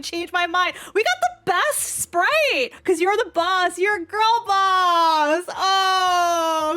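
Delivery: ordinary delivery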